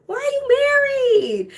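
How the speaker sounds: high, drawn-out mock wail; mock anguish. Lamenting that the handsome pilots are married, mock-anguished. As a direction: wail it in exaggerated protest.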